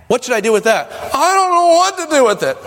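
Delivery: high, whiny helpless voice; high mock whine of helplessness. Imitating helpless person's question.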